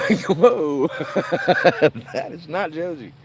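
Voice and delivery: high, loud words through laughter; cracking up at the mix-up; laughing shock. Real, not acted.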